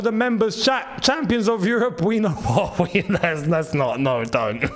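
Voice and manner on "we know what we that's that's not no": ordinary talk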